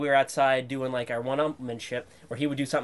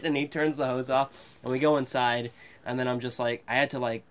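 ordinary delivery

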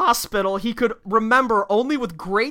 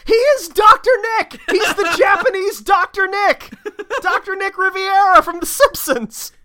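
excited; 9 semitones higher than usual. Real, not acted. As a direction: blurt it out with delight. Excited realization, laughing.

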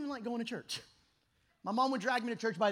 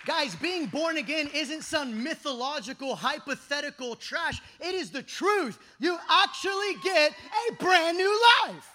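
loud, pounding, slowing on "a brand new life"; full-force preaching; 4 semitones higher, 7 dB louder than usual. Real, not acted. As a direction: hammer home the new life.

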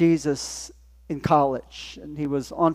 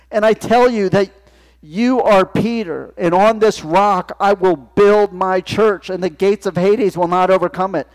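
loud, higher, slowed proclamation; preaching scripture; 3 semitones higher, 5 dB louder than usual. Acted. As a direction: declare the blessing with weight. Jesus' blessing read at full force.

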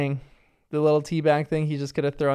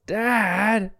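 higher-pitched, drawn-out eye-roll; exasperated child; 5 semitones higher than usual. Retold or imitated. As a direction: imitate a kid fed up with a silly dad. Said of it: "rolls her eyes and says".

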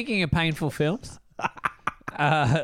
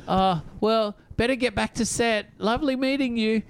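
higher, politely backing out; awkward Taylor Lautner; 8 semitones higher than usual. Retold or imitated. Actor wrapping up an awkward FaceTime call, polite higher exit. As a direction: end the call gracefully. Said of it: "Taylor Lautner's like".

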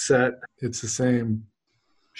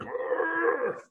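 giant's grunt; 6 dB quieter than usual. Acted.

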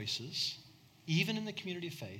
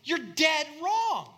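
very high, loud shout; shouted rebuke; 13 semitones higher, 8 dB louder, slower than usual. Retold or imitated. Paul shouting down his companions, very high and loud. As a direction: yell it at the mistaken companions. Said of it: "Paul's like".

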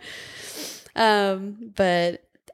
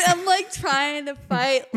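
ordinary delivery

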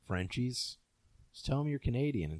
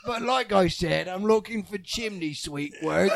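laughing mock British accent; 7 semitones higher, 5 dB louder than usual. Friend plays an English job seeker, laughing, mock British. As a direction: play a would-be chimney sweep, half laughing. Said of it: "if you go to London".

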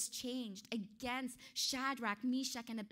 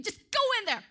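barked order, higher and much louder; angry shouted order; 8 semitones higher, 10 dB louder, faster than usual. Acted. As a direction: yell at the guards to throw them in. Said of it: "he was just so angry".

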